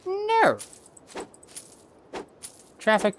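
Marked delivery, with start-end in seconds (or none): 0.1-0.6 shocked no. Real, not acted. Player reacts to in-game mishap.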